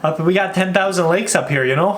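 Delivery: hammed-up Minnesotan accent, lilting up; thick Minnesota nice accent. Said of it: "people literally talk like this".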